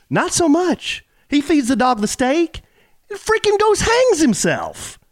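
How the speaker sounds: worked up, pitch jumping high in indignation; real bothered rant, voice climbing high. Real, not acted. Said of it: "Alan was very bothered by that".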